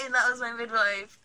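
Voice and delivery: normal talking voice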